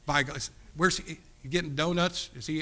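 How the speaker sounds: usual speaking voice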